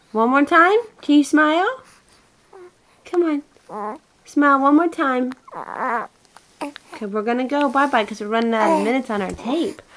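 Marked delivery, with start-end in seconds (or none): 0.1-5.3 high, louder rising questions. Real, not acted. Mother asking her baby for one more smile, high coaxing.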